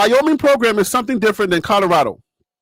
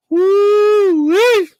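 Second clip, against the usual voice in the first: high, loud whoop with a laugh; excited woo-wee; 11 semitones higher, 6 dB louder than usual. Real, not acted. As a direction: let out a gleeful holler. Excited exclamation.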